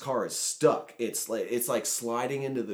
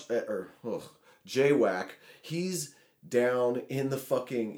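ordinary delivery, nothing marked

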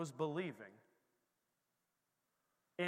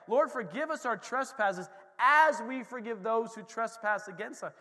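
emphatic prayer; 5 semitones higher, 7 dB louder than usual. Acted. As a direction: proclaim each word of the prayer. Recites the Lord's Prayer emphatically.

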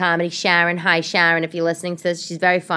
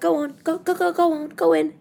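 higher mock-British shooing; British ex-husband; 11 semitones higher than usual. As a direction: mimic him shooing her out of the car. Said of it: "he's like".

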